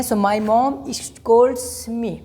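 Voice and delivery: So my mom scolds me drawn out very slowly; slow dictation. Shown as a demonstration. Teacher dictating an example to students, very slow. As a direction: dictate each word slowly so students can write. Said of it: "teacher dictates example sentence".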